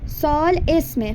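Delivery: ordinary talk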